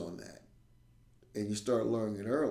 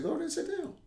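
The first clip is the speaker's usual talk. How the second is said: much higher, sing-song order; high coaxing teacher; much faster than usual. Retold or imitated. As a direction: lightly shoo him away. Teacher sending kid to bench.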